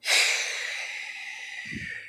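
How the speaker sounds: long heavy sigh. Real, not acted. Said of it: "Maybe I'm just stressed out".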